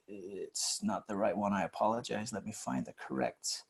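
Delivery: low, quiet aside while searching; soft, low apology while hunting for something; talking to oneself. Real, not acted. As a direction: drop the voice, half to yourself. Searching screen for the right example.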